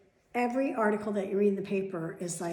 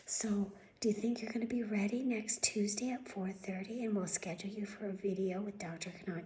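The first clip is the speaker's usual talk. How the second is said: mocking receptionist whisper; 9 dB quieter than usual. Mocking the doctor's office receptionist, soft whispered voice. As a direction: mimic her limp, whispery phone voice. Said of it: "this is how they talk".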